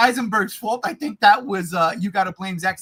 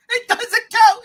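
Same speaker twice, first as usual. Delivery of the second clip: higher, louder repeat; repeated insistence; 12 semitones higher, 7 dB louder than usual. Real, not acted. Host repeating his objection, still loud. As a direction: press the point again.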